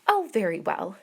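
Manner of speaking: loud eager exclamation. A narrator's reading. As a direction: burst out, relieved and eager. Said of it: "exclaimed Miss Bates".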